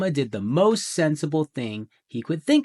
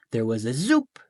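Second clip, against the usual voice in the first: plain reading voice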